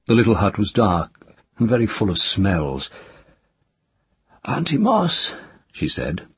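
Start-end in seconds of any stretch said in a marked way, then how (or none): 4.4-5.3 put-on lightly raised child voice; child's other voice. A narrator's reading. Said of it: "in the voice she had for these people".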